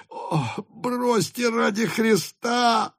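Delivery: high, slow cry of a wounded man. A narrator's reading. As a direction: plead out in pain. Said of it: "закричал раненый".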